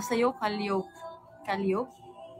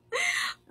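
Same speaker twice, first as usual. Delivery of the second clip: giggle. Real, not acted. Kilig reaction.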